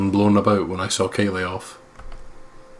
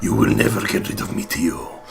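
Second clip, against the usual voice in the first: normal talking voice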